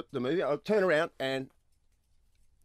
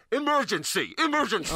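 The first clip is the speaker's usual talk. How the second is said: mock evacuation alarm call; PA announcement voice; 4 semitones higher than usual. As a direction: recite it like a building PA system. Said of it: "a speaker ... until it's going".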